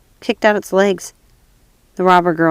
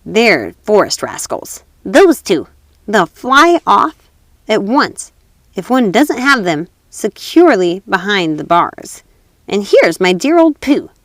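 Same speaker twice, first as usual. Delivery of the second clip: louder, slow showing-off voice; robber girl; 4 dB louder, slower than usual. Acted. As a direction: draw it out proudly.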